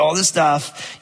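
ordinary delivery

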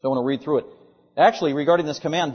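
ordinary delivery

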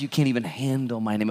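ordinary talk, nothing marked

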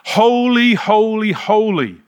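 high, loud ringing call that steps down. A narrator's reading. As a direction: call it out in praise like the seraphim. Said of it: "they were calling to one another".

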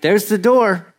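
acted indignant confrontation; confrontational. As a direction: show them the door, daring them. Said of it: "this isn't like, a, you know, gentle".

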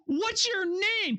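slow, high, wondering ask; slowed-down awed question; puzzled question. Acted. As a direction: ask it slowly, drawn out and curious. Moses asking God his name.

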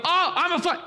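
high, squeaky cartoon fish voice; flying fish voice. Older brother voicing the fish he swings on the line, high cartoon voice. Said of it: "he would do this narrative of the fish".

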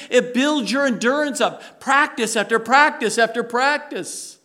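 preaching at full force. Acted. Pastor urging the congregation to endure trials, fervent preaching. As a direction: push it like a pulpit sermon building steam.

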